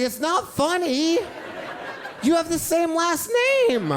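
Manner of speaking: put-on whiny kid protest. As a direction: protest like an indignant little boy. Kid protests laughing aunt.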